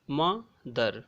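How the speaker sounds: "मदर" slowly as म, pause, दर; split into syllables. Shown as a demonstration. Teacher demonstrating two syllables, "मदर" split as म... दर. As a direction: break "मदर" into two separate beats. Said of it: "इस तरह से हमको दो बार बोलना पड़ रहा है".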